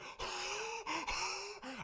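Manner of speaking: high, long stunned gasp; awed gasp. Retold or imitated. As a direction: gasp like your jaw dropped. Awed in the hug.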